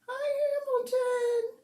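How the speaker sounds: cat talk. Real, not acted. Owner greeting her cat in a high squeaky coo.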